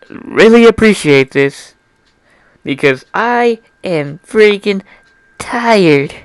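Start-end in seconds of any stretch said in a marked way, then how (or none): none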